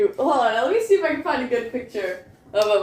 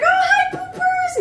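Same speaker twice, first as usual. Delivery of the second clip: put-on very high, sing-song baby talk to the dog; excited dog greeting; slower than usual. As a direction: squeal hello to the pet.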